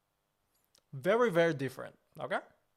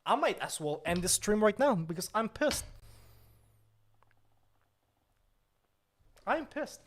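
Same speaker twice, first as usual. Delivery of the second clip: angry; 4 semitones higher than usual. Acted. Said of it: "I am angry".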